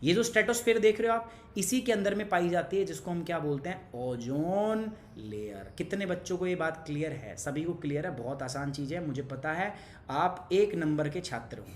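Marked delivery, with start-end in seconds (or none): 4.0-5.7 very slow, stretched syllables; drawn-out key term. Real, not acted. Teacher draws out the key term. (शब्द को खींचकर, धीरे-धीरे)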